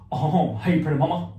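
crooning rock-and-roll idol impression. Player gives an impression clue, singer's swagger. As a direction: put on a famous swaggering singer's voice.

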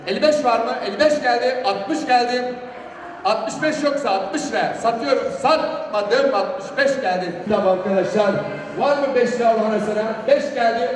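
loud, brisk auction bid calling; auctioneer. Real, not acted. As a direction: drive the bids up, calling out. (mezatçı gibi yüksek sesle teklif sayma)

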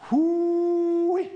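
high loud whoop of relief; joyful high whoop. Real, not acted. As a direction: let out a big whoo-wee. Whoop at mile twenty-three.

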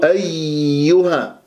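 أيها with the yā squeezed hard and long; over-pressed yā. Shown as a demonstration. Teacher demonstrates a faulty أيها, yā pressed and distorted. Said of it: "أضغط على المخرج يتشوه صوت الياء".

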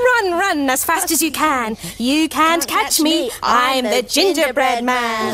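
lightly voiced, chanting biscuit runaway; gingerbread man's taunt. A narrator's reading. Runaway gingerbread man teasing his chasers, slow taunting chant. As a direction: stretch out the taunting rhyme. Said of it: "runaway gingerbread man just laughed".

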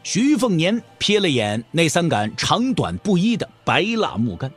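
plain reading voice

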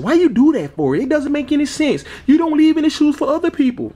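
high whiny complainer voice; critic's voice. Pretend. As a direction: voice the annoyed critics.

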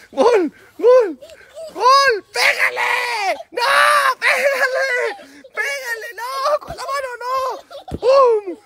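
very high, stretched-out squeals; frantic cheering. Real, not acted. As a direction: shriek playful coaching with long vowels. Grita indicaciones al niño jugando. (chillidos agudos de ánimo)

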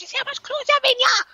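high-pitched mock Jamaican tirade; going mental. Mimicking a mother scolding over clothes, mock mental. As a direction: mimic a mother at the end of her tether. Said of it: "She was just going mental".

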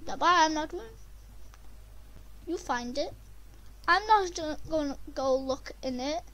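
normal talking voice

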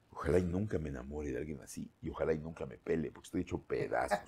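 soft, hushed retelling of a smitten thought; quietly voiced private thought; wistful inner thought. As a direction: murmur it like a lovestruck sigh to yourself. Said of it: "Yo la vi sentada y dije".

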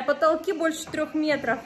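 ordinary talk, nothing marked

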